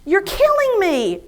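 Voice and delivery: re-enacted exasperated yell, high and drawn out; fed-up outburst. Wife to cautious husband, acting out being fed up. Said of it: "I'd had enough".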